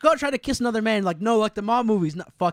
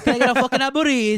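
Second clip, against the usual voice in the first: mock Italian accent; 5 semitones higher, 5 dB louder than usual. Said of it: "Italian accent".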